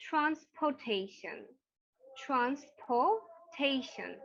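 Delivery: transportation stretched very slowly, falling; slow model pronunciation. Shown as a demonstration. Teacher models transportation for students, very slow. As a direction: draw out every syllable of transportation. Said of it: "The next one".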